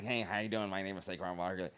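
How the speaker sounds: low, soft public-facing voice; code-switch into a quiet, polite introduction; Saquon Barkley. Acted. Player introducing himself in front of people, low code-switched voice. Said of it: "he code switches in front of people".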